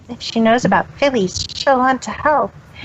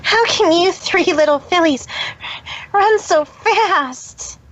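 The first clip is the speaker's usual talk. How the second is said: panting; 8 semitones higher than usual. Acted. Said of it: "Trixie panted".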